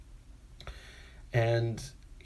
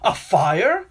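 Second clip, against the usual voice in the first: much higher and louder, disbelieving; indignant rant; 8 semitones higher, 7 dB louder, slower than usual. Real, not acted. Ranting to friend about the long bus ride, indignant. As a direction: build to outraged disbelief.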